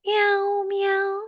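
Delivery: high, drawn-out playful meows; cat meow. Pretend. Teacher giving a child an animal clue, cat meow. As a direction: mimic a kitten on a high held note. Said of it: "What animal is".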